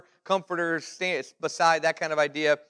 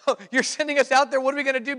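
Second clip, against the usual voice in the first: mock panic; 7 semitones higher, faster than usual. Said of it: "the disciples are freaking out".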